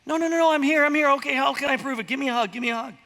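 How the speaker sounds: high, loud, eager mock God pleading to be believed; mock clingy God. Pastor's mock God reassuring Gideon, high and loud. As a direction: play a needy God begging for a hug.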